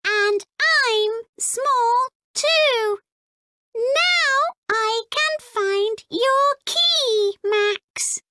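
a tiny cheerful robot voice; small robot voice. Acted. Robot Clunk speaks.

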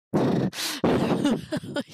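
high, airy burst of laughter; bewildered laugh. Real, not acted. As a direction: laugh helplessly as your brain gives up. Laughs at own math confusion.

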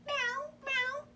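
very high little meows; cat meowing. A narrator's reading. Reader voicing the cat for children, high meowing. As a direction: mew high like a kitten. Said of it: "cried the cat".

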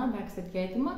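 ordinary delivery

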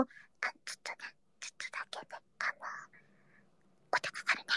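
very soft, hesitant whisper; hushed whisper. Real, not acted. Streamer confides that merch is pricey, hushed whisper. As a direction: whisper it as a discreet admission. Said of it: "ひそひそ助かる".

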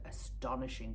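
ordinary delivery

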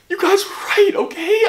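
half laugh, half sob, pitched high; laughing through tears. Acted. Admitting to fans he was wrong, laugh-crying. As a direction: break into a tearful laugh.

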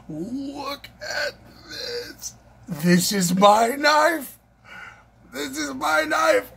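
high, slow, awed declaration; elated. Real, not acted. Showing viewers the knife he got, slow and high with elation. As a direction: savour that it's finally yours.

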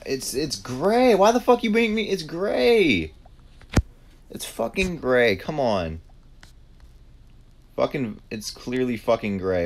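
high, loud, exasperated protest; outraged at a wrong accusation; real frustration. Real, not acted. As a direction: protest at top pitch, easing off slightly. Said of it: "I'm pissed. I'm fucking pissed".

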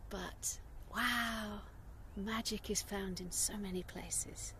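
whispered in wonder; soft breathy wonder; awed whisper. Real, not acted. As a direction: hush it like a secret of the land. Awed hush outdoors.

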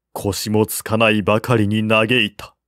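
ordinary delivery

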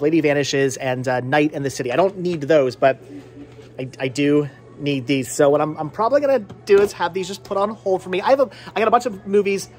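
usual speaking voice

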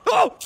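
high loud startled yelp. Real, not acted. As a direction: yelp in sudden fright. Startled by camera movement.

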